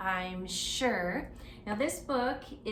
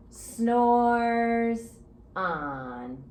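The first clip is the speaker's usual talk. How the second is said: snoring refrain; 5 dB louder than usual. A narrator's reading.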